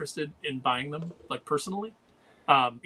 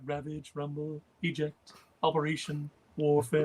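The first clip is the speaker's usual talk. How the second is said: Soundwave voice; slower than usual. Retold or imitated. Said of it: "talk into the fan to sound like Soundwave".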